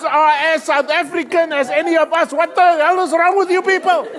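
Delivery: loud, high angry rant at the family; retold outburst. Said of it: "I said".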